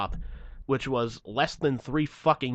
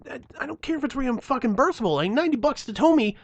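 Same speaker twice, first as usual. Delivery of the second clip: high-pitched, louder tirade of outrage; indignant rant; 10 semitones higher, 5 dB louder than usual. Retold or imitated. Driver venting about ninety-dollar tow, indignant. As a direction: pile up the grievances, voice pushed high.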